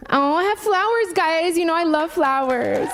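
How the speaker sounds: high, delighted gush. Real, not acted. Host delighted at getting flowers from the audience, high gush.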